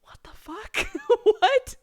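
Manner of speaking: high, stunned mock exclaim; retold disbelief. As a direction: replay the shock, pitch jumping up. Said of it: "we were like".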